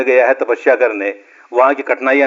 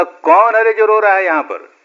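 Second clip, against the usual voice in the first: calling out; 7 semitones higher than usual. Retold or imitated. Calling out to unseen weeper in forest.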